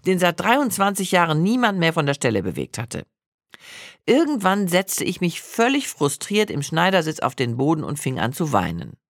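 usual speaking voice

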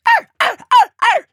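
high, loud yapping barks; dog barking. A narrator's reading. Teddy the dog sounding alarm, barking. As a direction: bark like an excited dog. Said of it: "bellte Teddy".

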